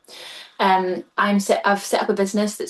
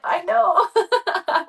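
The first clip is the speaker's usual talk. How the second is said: laughing excitedly; 11 semitones higher than usual. Retold or imitated. Excitedly agreeing with friend.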